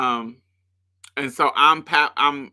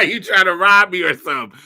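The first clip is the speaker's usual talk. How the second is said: high, louder, laughing through it; laughing mock worry; 7 semitones higher, 8 dB louder than usual. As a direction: tease their fear while laughing. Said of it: "side eye you like".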